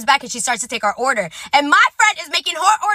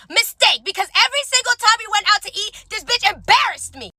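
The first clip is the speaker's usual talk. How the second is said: ordinary delivery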